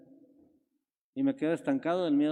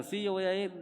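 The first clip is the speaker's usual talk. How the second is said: a lightly voiced, higher, eager [name]; 5 semitones higher, 4 dB quieter than usual. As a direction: insist you're going, a bit higher.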